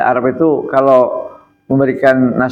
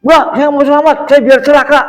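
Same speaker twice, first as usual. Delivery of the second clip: high, mocking voice of a silly refuser; mock refusal; 11 semitones higher, 4 dB louder than usual. Preacher mocks someone refusing a prayer, high and mocking. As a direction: squeak it like a foolish person turning down blessings. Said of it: "Nggak mau".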